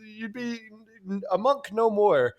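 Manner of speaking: high, loud voice of scoffing monks; hammed-up disbelief, pitched high and pushed; mock incredulous monks. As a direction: tease like a crowd of scandalized monks. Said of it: "all the monks erupt in laughter".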